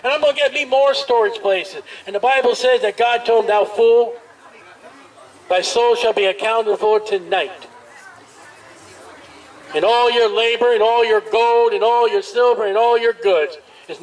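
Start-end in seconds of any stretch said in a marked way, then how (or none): none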